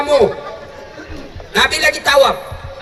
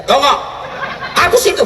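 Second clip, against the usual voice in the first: higher, laughing mock scold; 6 semitones higher than usual. Teacher scolding student. (ustaz memarahi mahasiswi sambil tertawa)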